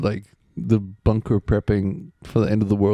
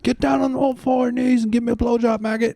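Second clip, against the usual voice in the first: barking drill sergeant, much higher. Retold or imitated. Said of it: "It's like a drill sergeant walks in and is like".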